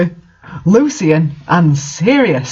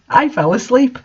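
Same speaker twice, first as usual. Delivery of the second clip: usual speaking voice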